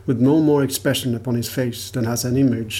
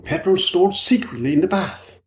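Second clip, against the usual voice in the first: high, excited character voice; excitedly exclaiming in a raised pitch; 6 semitones higher than usual. A narrator's reading. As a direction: seize on the theory eagerly. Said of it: "exclaimed Mister Middleton excitedly".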